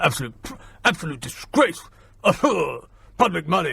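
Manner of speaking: lightly mocked huffing politician; mock indignation, broken by huffs and grunts; blustering Tory mimicry. Host mimics a Tory banging on about the fireworks, blustering. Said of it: "who was just banging on about the fireworks".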